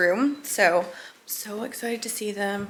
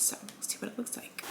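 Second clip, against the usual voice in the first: soft breathy whisper; 15 dB quieter than usual. Real, not acted. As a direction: hush it right down. Sneaking into baby's room.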